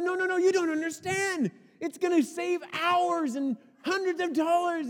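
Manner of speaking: high, insistent protest; eager pleading. Retold or imitated. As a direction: convince her urgently. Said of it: "I'm trying to tell her".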